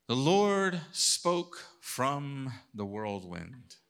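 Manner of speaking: very slow, weighty reading; solemn reading. Acted. Preacher reading the whirlwind verse very slowly. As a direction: draw each word out.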